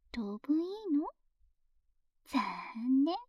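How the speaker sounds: ordinary delivery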